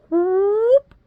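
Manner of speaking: high, loud whoop; sudden high-pitched cartoon whoop; imitated whoop. Imitating the hobo running at her, high whoop.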